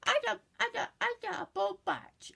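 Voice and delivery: high falsetto imitation of a giddy mother; sing-song high mom greeting; ditzy mom voice. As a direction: chirp it way up like a silly mom. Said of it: "came up to her and she went".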